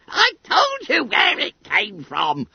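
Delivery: high, loud schoolboy squawk; indignant hoot. A narrator's reading. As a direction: hoot it out in a squeaky voice. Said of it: "hooted Bunter".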